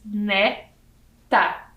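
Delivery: "Neta" in two beats, ne... ta; syllable by syllable. Shown as a demonstration. Teacher showing children two syllables, split apart. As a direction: count out "Neta" slowly, pausing between syllables. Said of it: "e duas sílabas".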